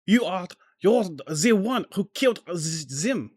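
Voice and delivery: put-on high, accusing French accent; bad French accent. Prosecutor accusing another of killing his partner, bad French accent. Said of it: "A, a really bad French accent".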